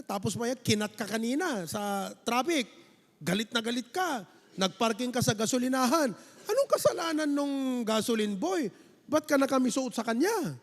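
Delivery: ordinary delivery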